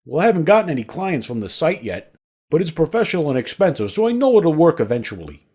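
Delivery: put-on thick Italian accent. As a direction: imitate an older lawyer's thick Italian accent. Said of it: "he replied in his thick accent".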